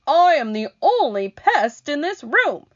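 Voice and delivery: high, loud, grinning boast; gleeful cry. Acted. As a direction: crow it proudly. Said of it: "cried the centipede".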